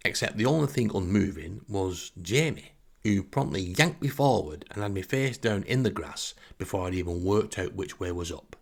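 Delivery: ordinary talk, nothing marked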